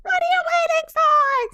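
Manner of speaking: high-pitched hammed-up shout into the empty air; mock movie scream. Friends quote a slasher film's famous line, mock scream. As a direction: cry it out like a horror heroine.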